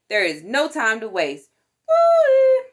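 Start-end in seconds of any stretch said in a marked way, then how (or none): 1.9-2.6 very high, loud whoop; a gleeful woo as the truck speeds off; excited whoop. Acted. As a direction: cheer like the siren's going. Fire engine rushes off.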